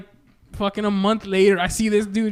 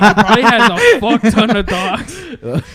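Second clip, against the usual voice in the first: slow, laughing, louder; laughing reply; 2 semitones higher, 10 dB louder, slower than usual. Retold or imitated. Teller answering the cashier, slow and laughing. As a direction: laugh through the words, slow.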